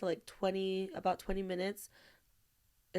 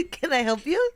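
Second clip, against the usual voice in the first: mock polite greeter; 6 semitones higher, 9 dB louder than usual.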